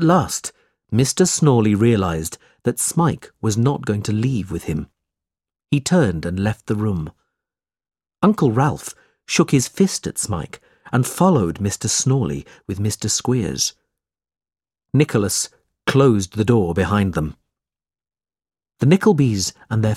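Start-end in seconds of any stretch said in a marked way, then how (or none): none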